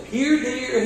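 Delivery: mock calling here deer in a high voice. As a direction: coax the deer back in a silly high voice. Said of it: "calling the deer mockingly".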